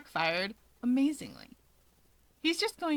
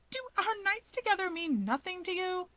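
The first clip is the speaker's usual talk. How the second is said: much higher, hammed-up melodrama; mock wounded; 9 semitones higher than usual. Keigo to Dabi, teasing with mock heartbreak. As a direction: play the jilted lover for laughs.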